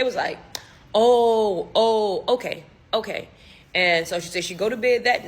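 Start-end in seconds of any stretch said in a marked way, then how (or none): 0.9-3.3 drawn-out, loud first oh, then settling; mock realization. Parents learning she never heard him talk, imitated knowing realization. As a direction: let it dawn on them, then accept it.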